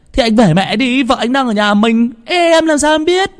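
very high, frantic exasperated cry; frantic urging. A narrator's reading. Tý urges Tửng to go home, frantic. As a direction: urge him home, pitch climbing into a squeal.

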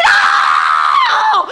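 low, loud groaning cry; desperate scream. Acted. Desperate friends wail at the hostess, low loud cry. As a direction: cry out in despair. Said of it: "Sus amigas ya desesperadas".

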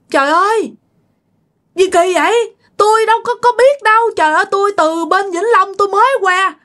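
very high, shrill-pitched disbelief; shocked protest. Retold or imitated. Widow denying the officer's blame, in shock. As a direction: cry out in shock and denial.

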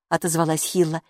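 ordinary delivery, nothing marked

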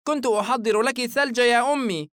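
put-on high boy's voice, protesting; little son pleading his case, pitched high; pained young boy. A narrator's reading. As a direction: play a hurt child explaining himself. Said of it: "الفتى ممسكا أذنه بألم".

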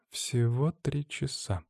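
slow, soft, preoccupied; hushed, drawn-out worry; mumbling. A narrator's reading. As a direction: mumble it thoughtfully to oneself. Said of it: "пробубнила она".

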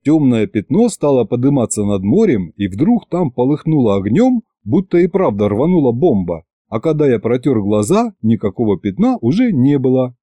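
plain reading voice

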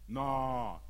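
low, drawn-out no; low dismissal. Acted. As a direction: turn down the offer, low. Rejects "all Italy" as too little.

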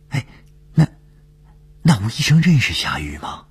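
high-pitched, halting question; curious colleague. A narrator's reading. Dongfeng asks whether Doctor Wu knows Xia Yu, curious.

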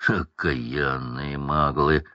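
low, slow growl; growled curse. A narrator's reading. Hagrid curses the Muggles, low growl. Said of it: "прорычал Хагрид".

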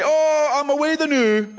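high-pitched stage Scots; broad Scottish. Shown as a demonstration. Scot announcing departure, high broad Scottish accent. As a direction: ham up a Scottish farewell. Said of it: "We say".

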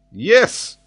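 high, loud, certain; a convinced yes; tone of conviction. Acted. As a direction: answer firmly and sure. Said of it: "in a tone of conviction".

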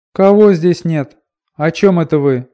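high, loud puzzled woman's question; surprised woman. A narrator's reading. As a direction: ask in startled surprise. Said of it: "послышался удивлённый женский голос".

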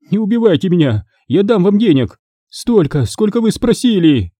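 high-pitched wailing woman; wailing plea. A narrator's reading. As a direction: beg for your life in a high lament. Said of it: "утробно запричитала баба".

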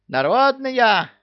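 high, loud, drawn-out squawk; parrot yell. A narrator's reading. Parrot indignantly echoing Люка, high drawn-out yell. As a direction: screech it in indignation. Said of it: "завопил попугай".

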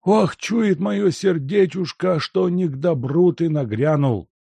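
put-on high, keening old servant fretting; lamenting wail. A narrator's reading. Servant fretting over the guest's arrival, high and lamenting. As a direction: moan about bad omens. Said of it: "причитал Лука".

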